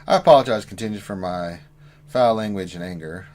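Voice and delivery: normal talking voice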